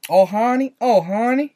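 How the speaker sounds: playful put-on Southern endearment; mock Southern accent. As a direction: stretch "honey" with a Southern twang. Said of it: "starting to talk a little Southern".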